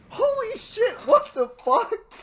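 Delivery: high, loud shocked yell; startled scream of disbelief; shocked outburst. Real, not acted. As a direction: blurt it out in total shock. Shocked reaction to game event.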